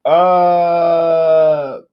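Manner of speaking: high, loud, drawn-out Spanish TV announcer voice; booming Spanish-language TV host impression; Telemundo announcer. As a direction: stretch each word like a Telemundo promo. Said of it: "you always hear the".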